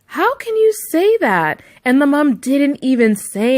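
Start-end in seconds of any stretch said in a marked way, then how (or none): 0.1-1.6 high, slow stunned what; shocked disbelief. Retold or imitated. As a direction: imitate her own outraged shock. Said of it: "And I was like".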